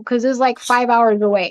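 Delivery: normal talking voice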